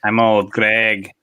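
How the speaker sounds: slow, drawn-out character voice; Old Greg. Acted. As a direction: stretch out the catchphrase in character. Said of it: "You gotta do the voice right".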